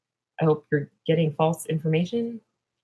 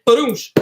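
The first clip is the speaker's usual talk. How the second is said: sudden loud, higher "badoosh" boom; imitated explosion; 5 semitones higher, 7 dB louder than usual. Imagining hemp wax exploding like a bomb, imitated explosion. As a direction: punch out a mock blast. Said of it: "would it be like".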